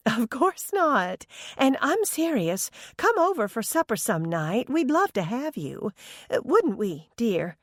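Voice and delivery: high chirpy mother voice; bright sing-song mom, eager; cheerful older woman. A narrator's reading. Mother Happy voiced in story.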